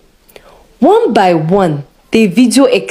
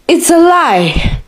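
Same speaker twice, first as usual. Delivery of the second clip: a touch of a raised protest, higher and slow; mildly shouted denial; narrated shout; 7 semitones higher, slower than usual. A narrator's reading. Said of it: "Deacon Matthew shouted".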